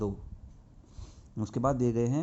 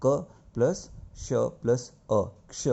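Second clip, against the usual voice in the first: slow spelling out; 2 semitones higher, slower than usual. Shown as a demonstration. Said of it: "teacher spells out letter parts".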